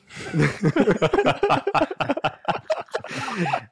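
real high-pitched laughing fit; laughter. Real, not acted. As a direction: break up laughing at your own story. Laughing at his own plight.

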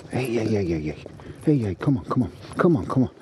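real quick, repeated dog call; rapid string of calls to a dog. Real, not acted. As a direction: call the dog back to you. Calling the dog mid-recording.

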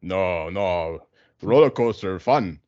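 hammed-up Russian tennis player; Russian accent. Russian player answering the interviewer after the match, mock Russian accent. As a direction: put on a thick comic Russian accent. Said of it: "And then the Russian's like".

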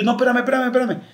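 más agudo, suplicando que esperen; detén al otro con urgencia; ruego urgente. Retold or imitated. (pide al muchacho que lo espere, urgido)

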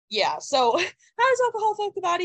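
high, laughing lesson opener. Shown as a demonstration. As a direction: laugh through the question. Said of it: "You'll get the lesson now".

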